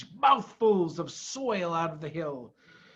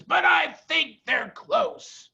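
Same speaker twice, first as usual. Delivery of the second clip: high, slow call; 10 semitones higher, 4 dB louder, slower than usual. A narrator's reading. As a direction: shout it across the dig. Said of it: "shouted Bogus".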